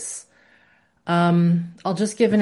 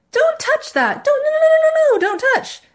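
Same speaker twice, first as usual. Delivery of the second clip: very high, loud, squealing panic; mock a frantic parent squeaking at a child; hammed-up high-pitched fretting; ineffective high warning; 6 dB louder than usual. Shown as a demonstration. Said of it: "But if it's a ... it doesn't work".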